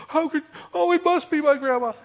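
very high, loud gullible exclamation; mock astonishment. Believer thinking a dead grandma speaks, high mock amazement. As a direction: squeal it like an awestruck believer.

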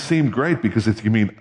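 ordinary delivery

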